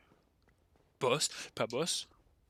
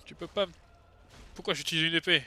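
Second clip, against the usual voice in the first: high, loud panic; panicked shouting; 8 semitones higher, 8 dB louder than usual. Real, not acted. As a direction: yell in gaming panic. Panicked boss fight.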